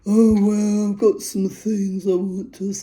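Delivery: loud mocking imitation of the husband's tone; his tone. Wife imitating husband's tone toward her, loud and mocking. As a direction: parody how he used to talk to her. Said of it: "this is actually how he used to speak to me in that tone".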